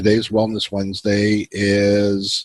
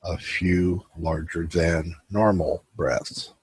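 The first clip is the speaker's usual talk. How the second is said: guided meditation; 3 dB quieter than usual. Acted. Host guiding his viewers into relaxation, calm guided meditation. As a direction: ease the listeners into stillness.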